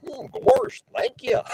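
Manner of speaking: high, whooping cartoon dog voice; Goofy voice. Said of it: "I'm gonna do my Goofy voice".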